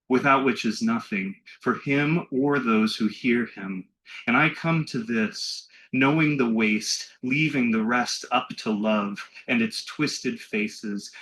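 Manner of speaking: slow, deliberate poem recital; poetry reading. Acted. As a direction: stretch each line out and let the pauses sit. Reads another poet's poem.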